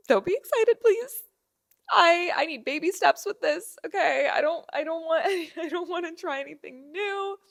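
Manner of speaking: anxious plea. Retold or imitated. Pleading with stylist.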